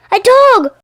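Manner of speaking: high, loud startled scream; soprano screams. Acted. Soprano shrieking as the dog leaps on stage. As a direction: shriek it out in fright. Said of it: "one of the sopranos screams".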